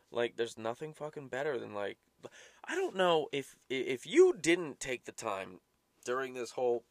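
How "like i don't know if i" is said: ordinary talk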